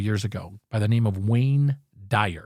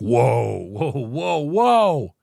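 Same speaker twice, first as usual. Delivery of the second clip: amazed whoa; much slower than usual. Retold or imitated. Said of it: "And I was like".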